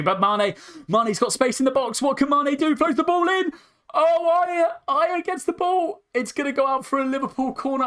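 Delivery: very high, straining excited commentary; screaming excitement. Real, not acted. Fan calling a last-minute chance in the box, straining high excitement. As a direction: voice cracking high at the big chance.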